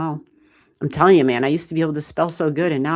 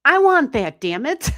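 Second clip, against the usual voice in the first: high, loud playful grab; hammed-up "I want that"; mock covetous outburst; 9 semitones higher, 6 dB louder than usual. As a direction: squeal it like a jealous kid. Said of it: "I'm like".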